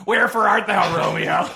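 high, loud hammed-up Juliet line; Stan Lee as Juliet. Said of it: "no, hey, Stan".

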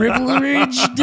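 high, slow, laughing mangle of "Privileged"; tongue-tied on "Privileged", giggling; botched pronunciation. Real, not acted. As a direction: drag "Privileged" out with muddled R's. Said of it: "don't even know how to say that word".

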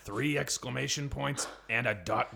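ordinary talk, nothing marked

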